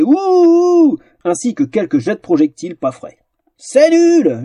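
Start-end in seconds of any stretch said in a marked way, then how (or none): 0.0-1.0 very high, loud boo; crowd booing. Acted. Displeased spectators boo the result loudly. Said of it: "le public manifesta sa désapprobation". 3.6-4.4 very high, loud jeer; drunken yell. Acted. The fat lady yells her disgust at the duel. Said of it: "hurlait la grosse dame".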